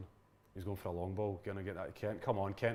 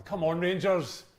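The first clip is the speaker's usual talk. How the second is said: yelling from the sidelines; 6 semitones higher, 5 dB louder, slower than usual. Real, not acted. Urging team on while watching.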